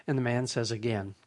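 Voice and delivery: ordinary talk, nothing marked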